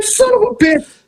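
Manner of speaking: high, exasperated cry. Retold or imitated. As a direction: blurt it out in disbelief. Exasperated reaction.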